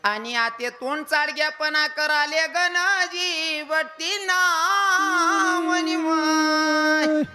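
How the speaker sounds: very high, loud, slow keening; keen the words like a professional mourner; wailing lament. Retold or imitated. (खूप उंच, मोठ्याने, संथ विलाप)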